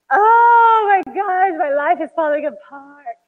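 a teary, half-sobbing moan of despair; an overwhelmed mom breaking down in mock tears; falling apart. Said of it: "and just crumbling".

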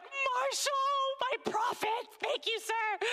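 choked up, thanking her son; tearful thanks. Retold or imitated. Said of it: "So I was like".

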